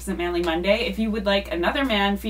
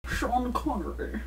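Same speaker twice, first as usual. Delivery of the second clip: slow, quieter Scottish Connery; Sean Connery accent; 2 semitones higher, 7 dB quieter, slower than usual. Acted. Host answers in Connery's Scottish accent, slowly. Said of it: "already got this one".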